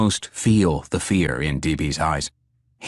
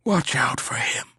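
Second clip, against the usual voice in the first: dying whisper; 7 semitones higher than usual. A narrator's reading. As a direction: breathe the last words out. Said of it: "began to whisper into Neil's ear".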